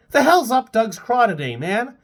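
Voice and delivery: incredulous. Acted. Phil exclaims about email.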